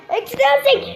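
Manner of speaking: high-pitched outburst; demonstrated yell. Shown as a demonstration. Streamer shows viewers how they would yell, shouting. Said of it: "diye bağırırım".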